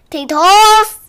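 high, loud call. Acted. Calling the runaway tortoise, high loud shout. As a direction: shout out for a missing pet. Tortoise has run away again.

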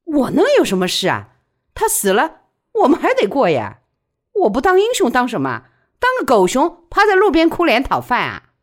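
loud, high-pitched comeback; defiant retort. A narrator's reading. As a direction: fire back at the accusation. Widow retorts in heated quarrel.

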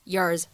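low, weakened "yours", like "yers"; weak form of YOURS. Shown as a demonstration. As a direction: say "yours" unstressed with a short, reduced vowel. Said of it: "我们可以弱读".

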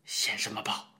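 whispered mock scold; low teasing scold. A narrator's reading. Teacher teases the boy in a whisper. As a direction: hiss a fond rebuke under the breath. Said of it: "低声笑骂道".

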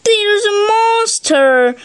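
high, mock-tearful child; acted high-pitched sob; sobbing. A narrator's reading. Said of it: "he sobbed".

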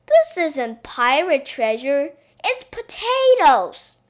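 loud, high, climbing to an outraged cry; exasperated little princess; indignant complaint. A narrator's reading. Princess gives up digging, disgusted.

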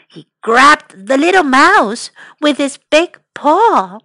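usual speaking voice

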